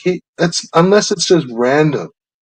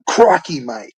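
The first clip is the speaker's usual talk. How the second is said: lightly mock a larger-than-life Australian wildlife host; Steve Irwin. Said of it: "like an over-the-top Steve Irwin".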